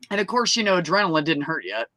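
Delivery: ordinary talk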